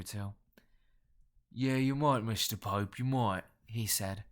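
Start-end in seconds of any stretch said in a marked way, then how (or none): 1.5-3.4 rough-throated Cockney smoker; guttural nasal Cockney. A narrator's reading. Smoker answering the collector, in guttural Cockney. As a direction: drop the consonants like a hoarse Cockney. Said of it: "guttural yet nasal Cockney accent".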